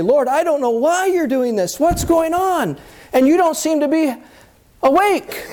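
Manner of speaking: very high, exasperated plea to the Lord; acted frantic prayer at the top of the range; distraught questioning. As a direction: cry out in bewilderment, pitch soaring. Acting bewildered believer crying to Lord.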